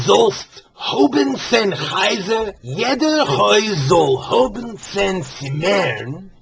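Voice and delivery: slow, deliberate Yiddish recitation; Yiddish curse. Shown as a demonstration. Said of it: "It goes like this".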